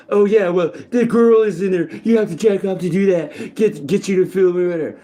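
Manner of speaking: lightly voiced taunting voice; gloating voice. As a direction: gloat as if you caught him out. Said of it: "gloating in its story".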